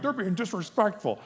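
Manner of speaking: high-pitched retold complaint; mock indignation. Dad complaining about his boys, mock indignant. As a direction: play up a dad's wounded protest.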